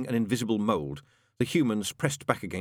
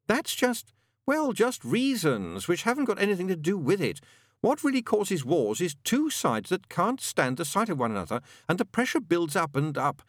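higher, exasperated demon lecture; world-weary Crowley; 8 semitones higher than usual. A narrator's reading. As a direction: explain it with mounting insistence, pitched up.

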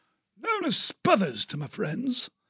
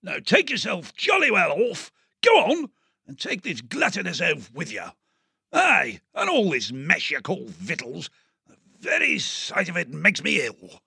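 high, blustering colonel with a shouted start; outraged old officer; 9 semitones higher than usual. Acted. Diggs berating the sister, as an outraged colonel. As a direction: bellow like an insulted officer. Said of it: "He was outraged".

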